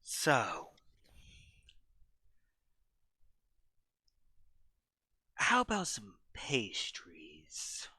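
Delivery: hoarse unvoiced whisper; stage whisper. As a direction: whisper it with menace. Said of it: "stage whisper voice".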